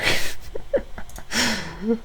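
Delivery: a soft, squeaky laugh; amused high titter; high quiet giggle. Real, not acted. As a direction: giggle up high under your breath. Laughing at the meme comments.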